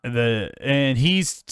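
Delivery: ordinary talk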